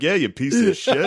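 hammed-up crowd-pleasing insult; mock heckle. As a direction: jeer along with the crowd. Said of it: "I'm like".

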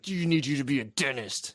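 mock demanding mother voice; put-on parental nagging; scolding mom. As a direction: imitate a pushy mom. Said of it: "She's like".

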